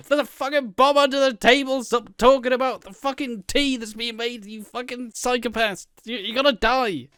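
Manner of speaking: high, loud, panicked warning; exasperated viewer shouting in a high voice; frantic mock yelling. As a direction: yell at oblivious characters on screen. Viewers yelling at oblivious characters.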